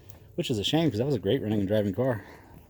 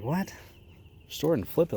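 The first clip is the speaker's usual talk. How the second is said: higher, disbelieving repeat of restore it and flip it; incredulous echo; 7 semitones higher than usual. Retold or imitated. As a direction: throw his plan back at him in disbelief. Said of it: "And I'm like".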